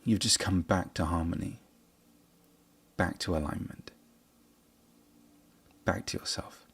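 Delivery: soft, fading to a breathy hush; soft soothing voice. Acted. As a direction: ease it down to almost nothing. Soothing meditation close.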